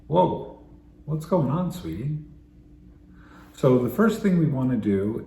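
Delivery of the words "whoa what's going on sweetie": gentle concern. Shown as a demonstration. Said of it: "you can say something like".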